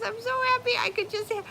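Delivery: squeaky high happy dog voice; voicing the dog. Speaking for the dog in her arms, high and squeaky. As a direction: play the pampered little dog. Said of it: "She says".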